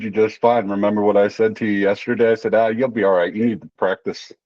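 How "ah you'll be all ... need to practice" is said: ordinary talk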